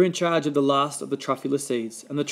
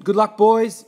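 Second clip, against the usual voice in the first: higher and louder call to the departing animals; cried farewell; 7 semitones higher, 8 dB louder, slower than usual. A narrator's reading. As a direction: call out after them as they leave. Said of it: "he cried".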